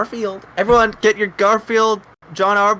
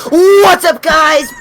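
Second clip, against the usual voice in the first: big energetic host intro; loud hype greeting; 7 semitones higher, 10 dB louder, much slower than usual. Acted. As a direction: shout it like a show opener.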